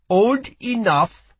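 put-on slow, high, loud young voice; proud defiance. A narrator's reading. Boy guard asked his age, proud defiance. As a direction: answer her defiantly. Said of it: "a proud tilt to his jaw".

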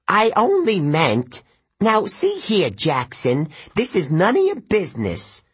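high, sputtering satyr backpedalling; flustered old satyr. A narrator's reading. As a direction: scramble to cover yourself.

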